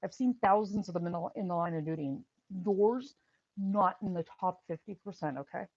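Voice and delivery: retold loud, exasperated snap; annoyed outburst. EMT fed up after thirty-five minutes of questions, loud annoyed retort. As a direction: punch it out loud, like patience has run out. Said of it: "'cause I was annoyed".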